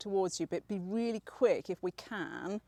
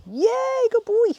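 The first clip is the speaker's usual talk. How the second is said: high, loud squeal of praise; excited dog praise; 13 dB louder, slower than usual. Real, not acted. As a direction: cheer the dog on, much higher and louder.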